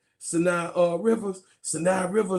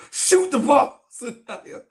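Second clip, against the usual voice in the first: mock coach yell; 7 semitones higher, 3 dB louder than usual. Calling out coach's order.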